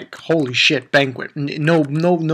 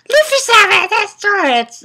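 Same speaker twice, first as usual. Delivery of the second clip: high-pitched mock princess squeal; imitating Shirahoshi; 5 dB louder than usual. Princess calls after departing crew, high mock squeal. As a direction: put on a very high girlish character voice. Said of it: "she's like".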